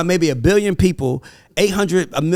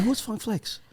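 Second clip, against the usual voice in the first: puzzled pastor; 4 semitones higher, 11 dB quieter, slower than usual. Retold or imitated. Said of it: "And the pastor like".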